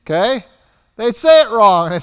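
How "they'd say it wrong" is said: ordinary delivery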